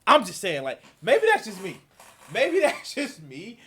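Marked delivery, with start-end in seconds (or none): 2.3-3.6 laughing mockery. Laughing mocking repeat.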